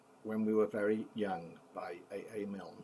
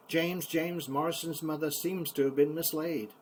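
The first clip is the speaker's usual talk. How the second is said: louder, higher town-crier announcement; proclaiming notice; 7 semitones higher, 7 dB louder than usual. Acted. King John's notice read out, loud like a town crier. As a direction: cry it out like a public notice.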